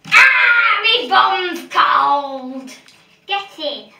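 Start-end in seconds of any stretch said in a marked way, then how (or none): 0.1-2.7 drawn-out shout; slow yelled complaint. Real, not acted. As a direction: slowly yell about the cold. Sitting down in cold bath.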